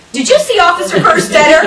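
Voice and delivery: loud, high retold question with laughter; knowing demand. Teacher asking kids who told them, loud and laughing. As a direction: put it to the kids as if catching on.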